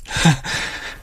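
short high giggle; brief involuntary laugh; hysterical laugh. A narrator's reading. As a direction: laugh helplessly after the order. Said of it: "Jeg må bare hele tiden le".

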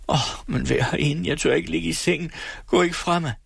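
high, urgent begging; pleading fear. Acted. Terrified Else begging the nurse to stay with her. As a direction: beg her not to leave.

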